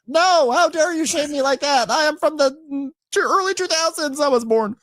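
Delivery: very high, affronted protest; hammed-up squeaky outrage; mock indignation. Cast member offended at being asked her age, mock indignation.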